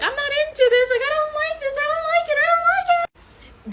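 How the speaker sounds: climbing high-pitched mock objection; emphatic whining protest. Woman making her dislike clear to him, whining protest. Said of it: "I made that very clear to him".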